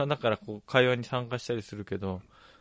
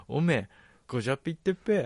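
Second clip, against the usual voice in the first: Ibaraki dialect imitation; faster than usual. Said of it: "表現してると思うよ、今の、訛りは".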